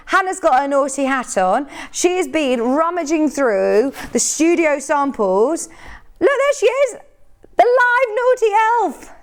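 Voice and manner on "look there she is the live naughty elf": high-pitched excited pointing-out; playful exclamation. Real, not acted. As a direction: announce the naughty elf in a high, teasing squeal. Playfully spotting colleague in elf hat.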